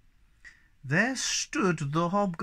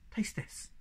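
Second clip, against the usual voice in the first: whispering; 6 semitones higher, 9 dB quieter than usual. Acted. Secretive offer of juice.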